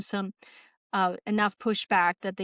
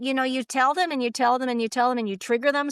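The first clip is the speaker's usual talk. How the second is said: usual speaking voice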